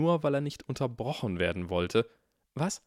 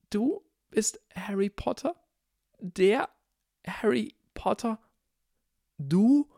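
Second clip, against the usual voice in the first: high, hushed, then louder in amazement; awestruck disbelief; 12 semitones higher, 5 dB louder, slower than usual. A narrator's reading. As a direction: whisper then blurt in wonder. Neville stunned by Harry's identity.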